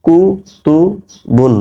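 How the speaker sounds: slow modeling of কুতুবুন; slow drawn-out reading. Shown as a demonstration. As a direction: draw কুতুবুন out syllable by syllable for students. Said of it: "বা দুই পেশ বুন".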